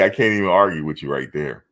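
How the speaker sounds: normal talking voice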